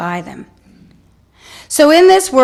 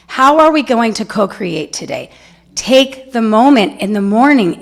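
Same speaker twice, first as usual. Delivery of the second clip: ordinary talk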